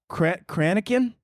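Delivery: "Cranachan" stressed up front, KRAN-uh-kun; stumble, then try "Cranachan" as KRAN-uh-kun; unsure pronunciation. Real, not acted. Host retrying a dessert name, unsure "Cranachan" as KRAN-uh-kun. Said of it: "I don't even know how to say".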